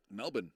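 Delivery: Melbourne as MEL-bin; Aussie pronunciation. Shown as a demonstration. Streamer confirms to viewers, Melbourne said MEL-bin. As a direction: say Melbourne with a short swallowed "bin". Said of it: "It's not Melbourne".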